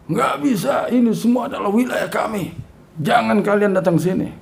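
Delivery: high-pitched rude jinn voice; rude arrogant jinn. Retold or imitated. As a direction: snap it out like a proud jinn. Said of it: "Kasar ngomongnya".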